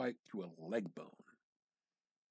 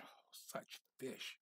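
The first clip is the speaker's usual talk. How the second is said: awed whisper; 4 semitones higher, 7 dB quieter, slower than usual. A narrator's reading. Narrator marvels at the fish.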